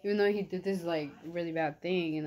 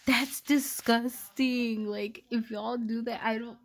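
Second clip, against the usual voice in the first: higher, louder and dragged out slowly, real disgust; grossed out; 5 semitones higher, 6 dB louder, slower than usual. Real, not acted. Warning viewers off kissing while eating, drawn-out disgust. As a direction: stretch out the disgust as if tasting it.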